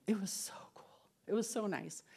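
soft hushed whisper of relief. Real, not acted. Telling the women how sleep came, whispered. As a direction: breathe it out in wonder.

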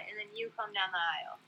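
ordinary delivery

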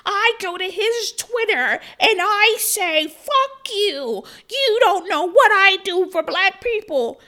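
high, slow, tearful mock whine. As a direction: whine it out like a near-crying accuser. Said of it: "And she was like".

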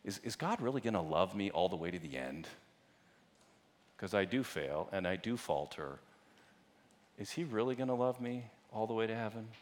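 soft, low, doubting inner voice; wondering doubt. Acted. Struggling believer asking themselves about God's love, soft and doubting. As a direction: voice a quiet, anxious doubt. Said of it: "you still wonder and you doubt".

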